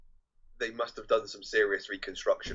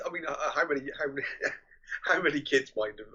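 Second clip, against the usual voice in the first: ordinary delivery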